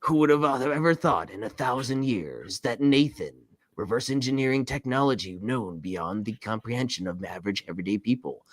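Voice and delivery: low, soft movie-trailer narrator; deep narrator voice. Retold or imitated. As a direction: intone it like an epic documentary narrator. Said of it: "It's like that deep narrator voice".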